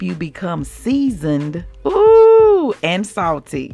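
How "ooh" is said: excited ooh. Real, not acted. Savoring the word seasoned.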